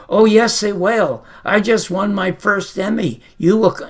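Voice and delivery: loud, high and eager protest; eager insistence. Retold or imitated. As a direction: push back, bursting with pride. Insisting on phone to Garrison.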